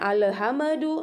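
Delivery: "Alhamdu" as a-le-ha-me-du; bounced lam and mim. Shown as a demonstration. Teacher demonstrates forbidden reading, "Alhamdu" with extra vowels. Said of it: "Lamnya dipantulkan".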